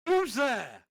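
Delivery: loud, high call of who's there; angry shout. A narrator's reading. Janitor searching the dark with a flashlight, shouting. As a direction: yell it into the dark. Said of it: "Shouted angrily".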